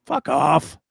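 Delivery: ordinary delivery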